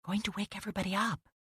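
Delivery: soft hushed warning, quick; hushing. A narrator's reading. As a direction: shush him softly. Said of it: "I put my finger to my lips".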